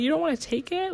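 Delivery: can't believe what he just said; incredulous. Retold or imitated. Thinking at her husband about the diaper bag, high incredulous.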